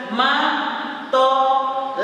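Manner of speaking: "Matola" slowly split into ma, to, la; syllable by syllable. Shown as a demonstration. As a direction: say "Matola" in three separate beats. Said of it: "Bate as palmas".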